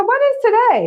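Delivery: high-pitched, incredulous retold question; pointed questioning. Woman testing the boyfriend who forgot her birthday, pointed and high-pitched. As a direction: retell it as a high, testing challenge.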